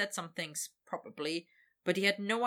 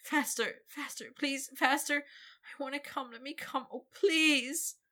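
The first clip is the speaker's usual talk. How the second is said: much higher, climbing frantic pleading; desperate begging; 8 semitones higher than usual. Acted. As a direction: beg faster and faster, rising. Victor begging dragon.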